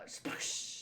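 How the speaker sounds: hushed, hissy splash sound; voiced splash. Narrator voicing the fall into water, breathy splash.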